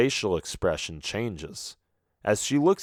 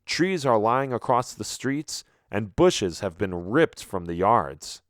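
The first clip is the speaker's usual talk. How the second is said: usual speaking voice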